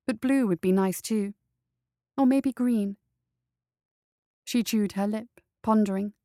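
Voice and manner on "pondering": ordinary delivery, nothing marked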